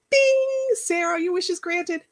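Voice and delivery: high, singsong mock wish-granter; magician granting wishes. Vlogger mocking the idea of God as magician, high singsong proclamation.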